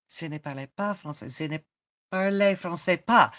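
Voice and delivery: quick retry. Shown as a demonstration. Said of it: "how you say it?".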